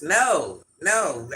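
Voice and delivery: ordinary delivery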